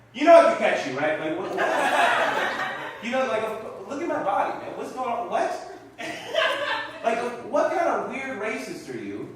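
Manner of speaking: indignant challenge. Acted. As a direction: challenge a stranger in exasperated disbelief. Acted challenge to fleeing passers-by.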